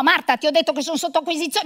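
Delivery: ordinary talk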